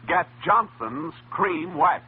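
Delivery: usual speaking voice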